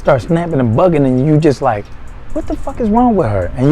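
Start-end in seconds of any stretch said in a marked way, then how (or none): 2.3-3.5 high, bewildered what-the-hell; a confused man's startled question; baffled outburst. Retold or imitated. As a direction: act floored by her sudden snapping. Baffled man facing snapping girlfriend.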